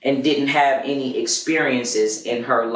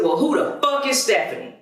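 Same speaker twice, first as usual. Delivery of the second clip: confronting; 11 semitones higher than usual. Retold or imitated. Confronting girlfriend in stairwell.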